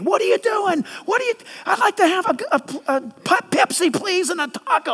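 lightly hammed-up animated diner; mock passionate chatter. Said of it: "They're talking like this".

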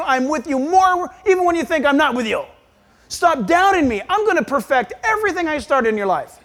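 high, forceful scolding reassurance; God exhorting firmly. Acted. God telling a doubting pastor to quit whining, forceful. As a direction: play God giving a tough-love pep talk. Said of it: "this is how I need to hear it".

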